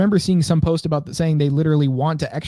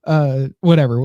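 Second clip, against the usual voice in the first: ordinary talk, nothing marked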